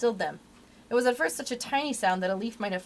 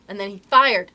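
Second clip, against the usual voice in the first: ordinary delivery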